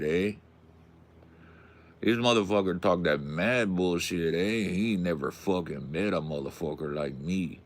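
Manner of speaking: put-on tough-guy Chicano street accent. Warning someone off his turf, put-on Chicano accent. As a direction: play a menacing vato with a Chicano accent.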